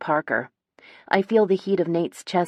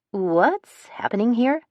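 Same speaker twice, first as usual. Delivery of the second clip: higher, slow, puzzled question; totally at a loss; 7 semitones higher, slower than usual. A narrator's reading. Sister asks her brother and friend, totally at a loss. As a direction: ask it slowly, bewildered, lifting at the end. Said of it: "totally at a loss".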